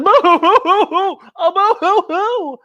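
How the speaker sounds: high, loud mock boo-hoo wailing; hammed-up monster sobbing. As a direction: wail like a crybaby monster. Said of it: "another monster says".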